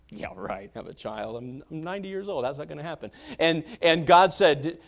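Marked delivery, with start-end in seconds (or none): 0.1-3.1 much softer, lower mocking mutter; scoffing under breath. Sarah eavesdropping in the tent, quiet scoff. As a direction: scoff quietly to yourself in disbelief. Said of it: "It was just kinda".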